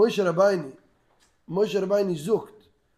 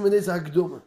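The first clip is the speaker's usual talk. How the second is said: normal talking voice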